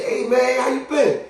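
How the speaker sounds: high, upbeat hello; hearty greeting. Acted. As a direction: hail an old acquaintance. Acting out greeting someone seen.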